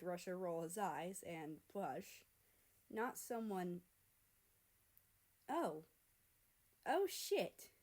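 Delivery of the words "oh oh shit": high, startled gasp of words; sudden realization. Acted. Russia realizes his feelings, startled and high. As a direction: realize it all at once.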